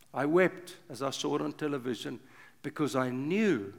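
ordinary delivery

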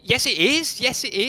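sudden high surprised yes; a real jolt of realisation, voice jumps up; startled it is. Real, not acted.

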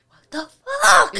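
sudden high mock yell, chest puffed; imitating Pedro yelling. Friend mimics Pedro getting loud, mock yell. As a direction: belt it out like a puffed-up hothead. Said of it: "all he does is get loud".

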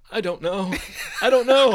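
mock meltdown, high and climbing to a loud cry; hammed-up helpless wail; Forrest Whitaker breaking down. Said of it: "He just cried".

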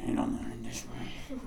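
whispered, grudging complaint; muttered under breath. Acted. Son complaining about mom's dishwasher order, muttered under breath. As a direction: grumble it so nobody can quite hear. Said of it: "complained under your breath".

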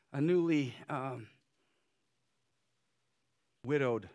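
ordinary delivery

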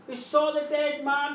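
ordinary talk

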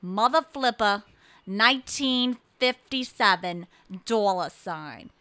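loud, emphatic New England caller; exasperated password announcement, New England accent; talking loud. Retold or imitated. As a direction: declare each piece of it loudly. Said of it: "And she's talking loud".

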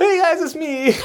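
put-on high, sweet little-girl greeting; innocent girl voice. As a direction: play it breathy and naive, wide-eyed. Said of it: "You sound like this innocent girl".

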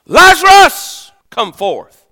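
high ringing call of the name, then dropping; loud voiced command of Jesus. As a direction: call Lazarus out of the tomb. Voicing Jesus calling Lazarus.

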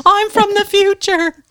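hammed-up sci-fi movie line. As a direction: quote your own film line with mock drama. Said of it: "an actual line in my movie".

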